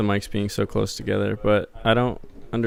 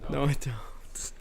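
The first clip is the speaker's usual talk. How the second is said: whispered; 4 semitones higher, 8 dB quieter, slower than usual. Real, not acted. Quiet denial to friend.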